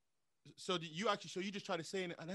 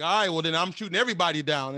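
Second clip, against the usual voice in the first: louder, combative declaration; quoting Dante's defiance; 7 dB louder than usual. Retold or imitated. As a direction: push it out louder, like a challenge. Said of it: "he would say".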